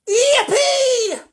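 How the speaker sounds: very high, loud, stretched whoop; joyful yell. A narrator's reading. Old man explodes with joy at the ticket, yelling.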